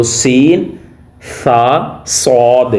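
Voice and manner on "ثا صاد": ثا and صاد said very slowly and kept apart; separate sounds. Shown as a demonstration. Teacher sums up the letter sounds. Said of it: "ان کی آوازوں کو آپ نے علیحدہ علیحدہ نکالنا ہے".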